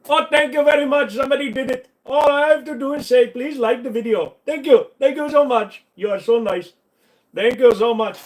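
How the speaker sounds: high, loud over-the-top gratitude; hammed-up high gushing thanks. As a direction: thank them like they saved your life.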